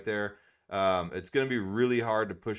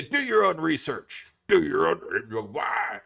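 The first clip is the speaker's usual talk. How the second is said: higher, louder mock lecture; mocking know-it-alls; 4 semitones higher, 4 dB louder than usual. Vlogger mocking people who answer questions with do your own research. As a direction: imitate the smug crowd telling you off. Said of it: "then everybody would say".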